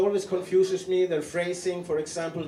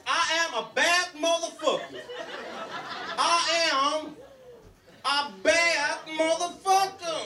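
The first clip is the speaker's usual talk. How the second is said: strut it out, proud and silly; Samuel L. Jackson; 11 semitones higher than usual. Retold or imitated. Comic imitating a movie tough guy bragging, high and hammed-up. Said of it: "they go like".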